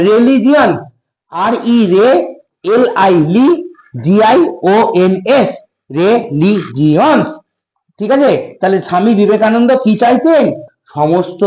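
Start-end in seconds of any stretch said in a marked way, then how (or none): 2.7-7.3 "রিলিজিয়নস" stretched slowly; slow dictation. Shown as a demonstration. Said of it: "উচ্চারণ দেখবো".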